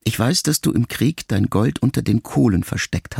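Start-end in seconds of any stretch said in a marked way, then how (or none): none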